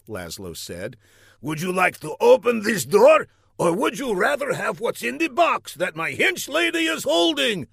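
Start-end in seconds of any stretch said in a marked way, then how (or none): 1.4-7.7 high, sing-out showman villain; villain game-show host. A narrator's reading. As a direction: tempt him with the choice like a game host.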